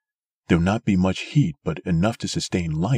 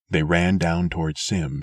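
ordinary delivery, nothing marked

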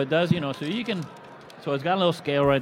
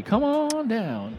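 game show host; 9 semitones higher, much slower than usual. Pretend. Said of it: "Price is Right".

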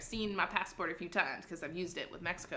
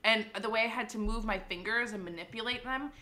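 ordinary talk

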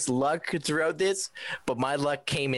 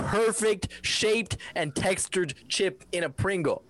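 heated; 7 semitones higher than usual. Real, not acted. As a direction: argue it like it matters. Heated chip argument.

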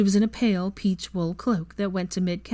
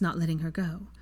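usual speaking voice

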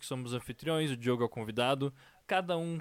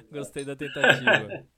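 laughing; 2 semitones higher, 8 dB louder than usual. Real, not acted. Laughing at friend's compliment.

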